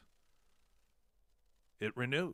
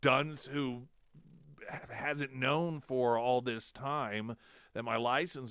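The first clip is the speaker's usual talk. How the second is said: louder, slow self-mocking confession; self-deprecating; 5 semitones higher, 5 dB louder, slower than usual. Telling the clerks it's her fault, rueful. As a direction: own the blunder with a rueful grin. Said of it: "I was like".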